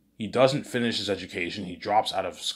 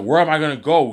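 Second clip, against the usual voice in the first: desperate plea; 7 semitones higher, 10 dB louder than usual. Acted. Son begs after being thrown out.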